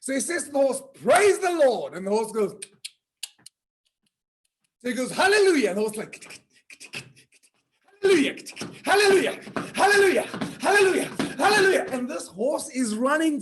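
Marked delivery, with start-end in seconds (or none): 1.0-1.9 high, louder call; loud proclamation. Acted. Rider telling the horse to walk, high and loud. 5.1-11.8 high, loud repeated exclamations; excited cries. Acted. Rider urging the galloping horse with high loud cries.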